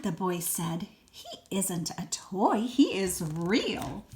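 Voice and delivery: put-on lively read-aloud for a small child; expressive storybook reading; story narration. A narrator's reading. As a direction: read it like a bedtime picture book. Reads picture book to child.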